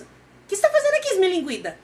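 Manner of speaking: very high, loud, fast cry of surprise; shocked. Retold or imitated. Chef spotting his ex in the restaurant, shocked and loud. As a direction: gasp it out, can't believe she's here. He sees the unwelcome ex arrive.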